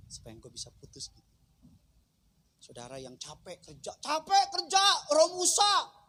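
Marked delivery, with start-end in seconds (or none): 4.0-6.0 high, loud, ringing sermon climax; preaching at full force. Acted. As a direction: drive the point home to the tired crowd. Preaching climax about rest. (khotbah lantang penuh semangat)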